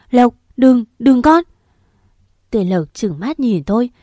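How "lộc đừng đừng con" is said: loud, higher desperate cry; shouting. A narrator's reading. Mother screaming at her son not to cut himself, loud. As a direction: scream at him to stop. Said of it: "Xung quanh có tiếng hét".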